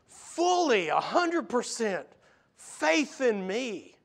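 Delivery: high, swelling exasperated plea; urgent preacher voicing a pleading Jesus; impassioned pleading. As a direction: build the plea, stretching the key words. Preacher voices Jesus pleading with believers.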